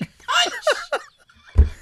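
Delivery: high, loud laughing repeat; laughing exclamation. Real, not acted. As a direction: burst out laughing on it.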